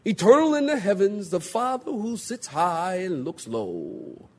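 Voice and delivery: drawn-out, pompous mock prayer; lofty King James prayer. Preacher mocks people praying in big words, pompous prayer voice. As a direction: open on a loud swelling "Oh", then slow down grandly. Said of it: "talking the Qu-, King James Version".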